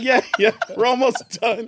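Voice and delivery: high, loud, laughing bluff; nervous cheerful reply. Retold or imitated. Derek bluffs to the cop, high and loud. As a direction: play it cool while scared. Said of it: "I said".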